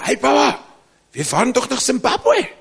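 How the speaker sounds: put-on high, loud protest of children; children shouting. Children in the car correct their praying father, shouting. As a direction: imitate kids yelling out in alarm. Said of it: "Dann schreien die Kinder".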